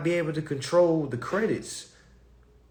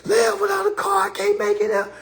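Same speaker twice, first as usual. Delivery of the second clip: mock crying; 13 semitones higher than usual. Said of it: "you crying, like".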